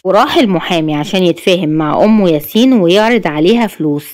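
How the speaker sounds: normal talking voice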